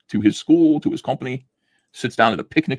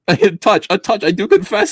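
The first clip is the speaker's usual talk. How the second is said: higher, louder stilted fencer; hammed-up gentleman fencer; mock formal duelist; 10 semitones higher, 6 dB louder than usual. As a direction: declaim it like an old-fashioned duelist.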